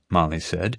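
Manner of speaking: ordinary delivery, nothing marked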